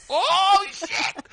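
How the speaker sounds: very high, loud mock squeal; wildly excited. Imitating husband who sees a fight coming, wildly excited. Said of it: "he got so excited".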